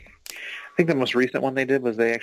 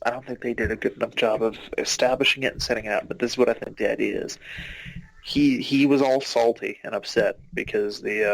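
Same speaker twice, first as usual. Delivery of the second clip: normal talking voice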